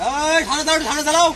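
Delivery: high, loud urgent call; excited shout. Real, not acted. Shouting to boatman near tiger.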